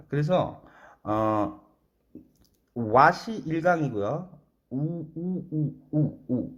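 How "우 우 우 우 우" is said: 우 with rounded lips, pushed forward; English u sound. Shown as a demonstration. Teacher modelling the English u sound, rounded and held. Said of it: "영어의 우".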